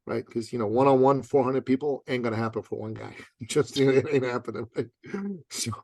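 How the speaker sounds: ordinary talk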